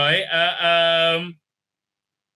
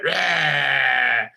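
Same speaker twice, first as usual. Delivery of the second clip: mock monster roar; 3 semitones lower than usual. Mimicking a bad monster.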